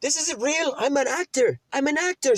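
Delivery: very high mock British voice; squeaky, panicky British impression; British dude pleading. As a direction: imitate a scared Brit begging off. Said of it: "He's like some British dude".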